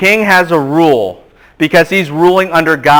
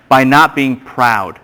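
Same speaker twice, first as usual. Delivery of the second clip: slow, spelled-out dictation pace; 3 semitones lower, slower than usual. Shown as a demonstration. Pastor dictating notes to the class, slow and deliberate. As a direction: say it slowly so they can write it down. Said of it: "just write this down".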